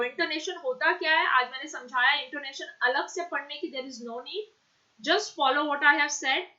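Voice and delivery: usual speaking voice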